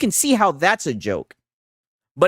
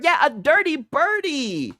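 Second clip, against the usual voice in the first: slow teasing repeat; 5 semitones higher, slower than usual. Real, not acted. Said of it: "Sigs is a scumbag and a dirty bird".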